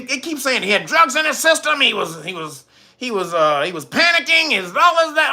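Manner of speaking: high, mocking run of excuses; mock alarm. Imitating those excusing the death, mock alarmed. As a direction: parrot the excuses in an exaggerated high voice. Mimicking repeated media excuses.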